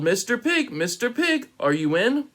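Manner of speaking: high sing-song call. A narrator's reading. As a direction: call up to the door sweetly. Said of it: "I called".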